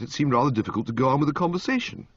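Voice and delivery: ordinary delivery, nothing marked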